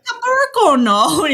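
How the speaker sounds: high, loud, slow sing-song shop man; gelato man. Retold or imitated. Gelato seller answering the boy, slow sing-song. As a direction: draw the word out like a cheerful vendor. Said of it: "the guy's like".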